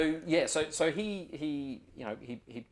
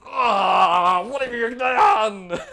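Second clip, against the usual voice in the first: much louder, higher, slow horrified yell; scientist's outraged shout; 9 semitones higher, 16 dB louder, much slower than usual. Acted. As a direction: scream at the ranger for ruining the samples. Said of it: "The scientist is basically just going".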